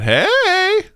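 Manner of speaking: high, stretched-out "hey"; hammed-up high holler; drawn-out hey. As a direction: call out a long, high greeting. Reading a comment, calling out.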